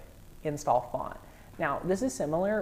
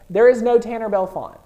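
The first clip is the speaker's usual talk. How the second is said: loud, high announcement; over-excited; 7 semitones higher, 13 dB louder than usual. Real, not acted. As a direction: call it out loudly, carried away with excitement. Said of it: "I'm getting too excited".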